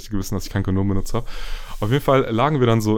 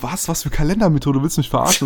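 incredulous, raised and indignant; retelling how he got angry at her; angry disbelief; 5 semitones higher than usual. Retold or imitated. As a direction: snap back in outraged disbelief. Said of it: "ich bin wirklich richtig sauer geworden".

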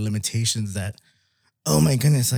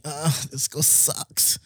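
bored complaint; 3 semitones higher, 6 dB quieter, much slower than usual. Retold or imitated. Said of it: "I'm like".

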